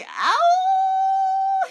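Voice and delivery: very high, loud wolf howl; hammed-up wolf howl. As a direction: let it out as a howl. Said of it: "He howled".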